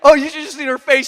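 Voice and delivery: normal talking voice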